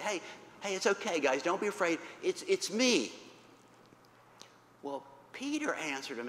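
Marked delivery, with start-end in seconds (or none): 0.0-3.1 higher, louder reassuring call. Acted. Jesus to frightened disciples at night, calling out. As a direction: call out over the water to calm them. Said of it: "Jesus calls out to them".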